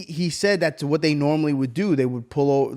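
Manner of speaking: normal talking voice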